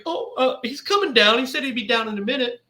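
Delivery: lightly acted wife, placating; lightly voiced wife. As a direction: lift the pitch for a hint of the wife. Voicing the wife answering him.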